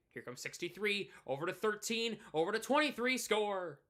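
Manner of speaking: mock hockey commentator, rising in pitch and volume; play-by-play call. Streamer imagining a call of number sixty-three, building play-by-play. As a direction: build excitedly to the goal call.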